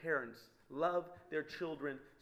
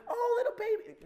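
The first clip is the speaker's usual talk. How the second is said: very high mock cooing voice; hammed-up baby talk; doting parent; 4 dB louder than usual. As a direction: coo over a baby in falsetto. Imitates doting parents over baby.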